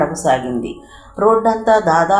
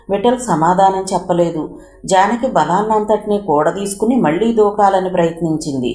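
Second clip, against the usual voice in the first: plain reading voice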